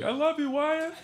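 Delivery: high, soft lovestruck fan voice; adoring fan. Shown as a demonstration. Comic shows a fan what to call out, high adoring voice. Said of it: "I want you to be like".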